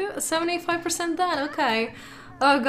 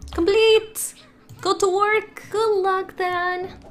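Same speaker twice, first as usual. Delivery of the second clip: cheering; 6 semitones higher, 3 dB louder, slower than usual. Real, not acted. Cheering Dan off to work.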